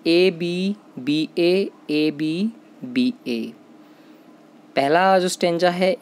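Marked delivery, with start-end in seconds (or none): 0.1-1.7 ए बी बी ए spelled out slowly, each letter apart, rising a little at the end; teacher slowly spelling ए बी बी ए for students to write down; slow dictation. Shown as a demonstration. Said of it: "वो क्या बनता है?". 1.9-3.5 ए बी बी ए spelled slowly again, falling to close; teacher repeating ए बी बी ए slowly and finishing it off; slow settling repeat. Shown as a demonstration. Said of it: "वो क्या बनता है?".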